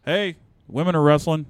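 re-enacted dad's holler; yell to other room. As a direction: call out to someone down the hall. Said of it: "I'll yell in the other room".

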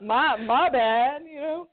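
laughing. Real, not acted. Laughing apology at the gate.

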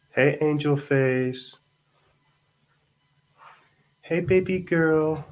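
high, slow cooing to an infant. Real, not acted. Dad cooing to his baby.

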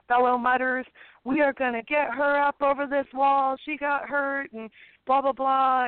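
high, rousing call to the crowd; rally the crowd like an event host; a hint of an upbeat event host; rallying announcer. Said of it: "she was like".

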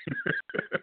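high-pitched soft laugh. Real, not acted. Host amused by his own act, high laugh. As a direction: let out a high little laugh.

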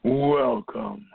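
high spooky welcome; imitating the creepy welcome. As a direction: echo the eerie intro voice. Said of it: "I don't know if I can do it justice".

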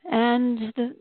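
ordinary delivery

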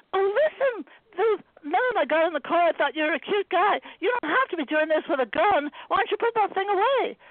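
high, coaxing, anxious plea; nervous pleading. Acted. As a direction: sweet-talk him out of fear. Anna pleading, flattering.

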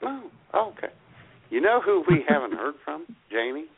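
usual speaking voice

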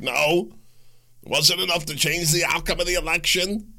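high-pitched mimicking objection; mock dismissal. Acts out people dismissing the claim.

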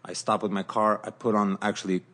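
usual speaking voice

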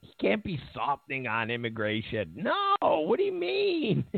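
high, whiny mock denial with a fake sob; hammed-up imitation of outraged critics wailing no; mock panicked protest. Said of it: "They're like".

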